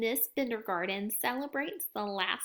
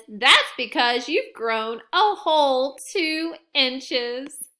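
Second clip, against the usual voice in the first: loud slow explaining; 8 dB louder, slower than usual. Acted. Child explains, teasing.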